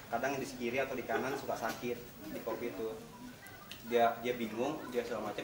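normal talking voice

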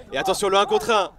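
rising shout on the chance; excited sports commentary. Real, not acted. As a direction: build to a loud cry on the shot. Late chance, commentator rises.

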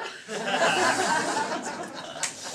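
high airless laughter; real laugh at his own impression; breathy laugh. Real, not acted. Laughing after mom imitation.

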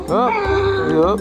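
usual speaking voice

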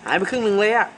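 high, loud shocked wail about the loss; shocked cry. Real, not acted. Repeats loss of half his items.

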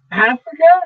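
high, loud astonished Africa?; exclaiming. A narrator's reading. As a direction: blurt it out in disbelief. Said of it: "exclaimed Linda".

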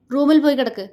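loud, high, serious command; stern order. A narrator's reading. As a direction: send her off firmly. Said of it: "ഗൗരവം വിടാതെ പറഞ്ഞു". (ഗൗരവം വിടാതെയുള്ള ആജ്ഞ)

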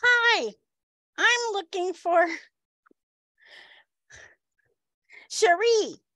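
comically high, incongruous voice; high-voiced big man. Muscleman asks for a coworker, surprisingly high voice. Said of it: "Is that really his voice?".

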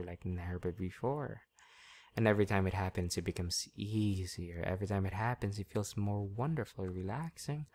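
steady, soothing trance patter; hypnotic induction. Real, not acted. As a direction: ease them down into trance.

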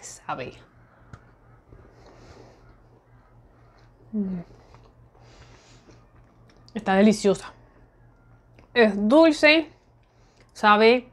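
small bites of biscuit; talking while chewing. Real, not acted. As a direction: mouth partly full. Seen 0: Holds biscuit piece, bites at 2.8s, chews while talking.

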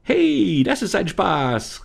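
high, loud, wild cry of glee; gleeful shout. Acted. False Lisa romps through the laundry, high gleeful shout. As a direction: shout high with mischievous delight. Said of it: "rief das Wesen".

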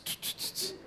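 soft unvoiced tss like a leaking car; car hissing noise. To the mechanic, showing how the car sounds, a soft hissing tss. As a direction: imitate the car's hiss for the mechanic. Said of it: "el carro hace como que tss".

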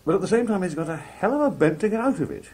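high, loud put-on Scouse; Scouse accent. Shown as a demonstration. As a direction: pitch it right up into Liverpool Scouse. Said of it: "I lapse into Scouse".

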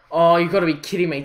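usual speaking voice

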